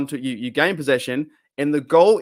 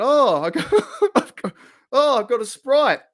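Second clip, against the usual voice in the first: laughing impression; 10 semitones higher, 4 dB louder than usual. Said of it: "he looked in his bag and went".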